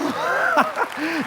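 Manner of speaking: short high-pitched laugh. Real, not acted. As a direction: burst out laughing. Laughs before story. (risa aguda y breve)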